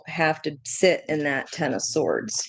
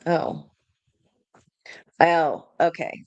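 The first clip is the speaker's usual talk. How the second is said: normal talking voice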